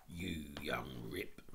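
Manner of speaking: menacing, drawn-out grumble; threatening policeman. Acted. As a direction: constable scolding a cheeky boy.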